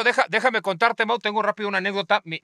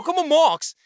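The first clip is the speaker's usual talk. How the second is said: baffled echo; 9 semitones higher, much slower than usual. Retold or imitated. Retold baffled reply to British word.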